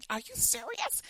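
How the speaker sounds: high, incredulous squeak of surprise; shocked disbelief. Retold or imitated. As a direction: toss it up like you can't believe your ears. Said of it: "I was really shocked".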